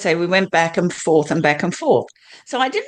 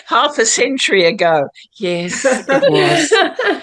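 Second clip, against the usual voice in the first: high, slow, laughing it off; rueful laughing admission; 7 semitones higher, 3 dB louder than usual. Real, not acted. To the host, admitting she left half a century ago, laughing. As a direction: laugh through the words, owning how long it's been.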